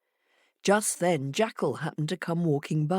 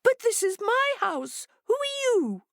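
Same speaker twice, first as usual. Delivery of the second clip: much higher, alarmed little hare; startled hare. A narrator's reading. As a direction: squeak it out in sudden alarm. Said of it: "Hare jumped back from the door in alarm".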